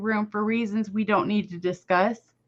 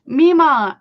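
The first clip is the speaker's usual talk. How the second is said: calling out; 4 semitones higher, 9 dB louder than usual. Real, not acted. Calling out to a viewer.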